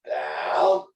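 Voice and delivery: down, said low then rising at the end; rising command. Shown as a demonstration. Trainer walking the owner through the down drill, rising. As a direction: lift the end of down, gently. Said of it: "It looks like this".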